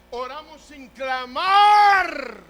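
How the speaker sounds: high, loud, drawn-out final word; slow impassioned shout; crying out. Real, not acted. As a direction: build to a long pulpit shout. Preacher climaxes a list.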